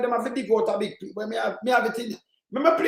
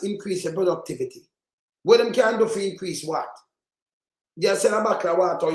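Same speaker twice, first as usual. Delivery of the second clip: ordinary talk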